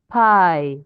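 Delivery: loud, long 'Pie'; stressed single syllable. Shown as a demonstration. Teacher restarting the demonstration, 'Pie' stressed as one beat. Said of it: "that is why we pronounce".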